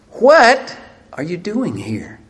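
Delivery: "What" drawn out high and loud, then a break; stress on What. Shown as a demonstration. Preacher demonstrating God's question, stress on "What". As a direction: hit "What" hard, slow down the question. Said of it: "Accent on the what".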